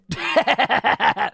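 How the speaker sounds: loud bright laugh; burst of laughter. Real, not acted. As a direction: break into laughing. Laughs after joke.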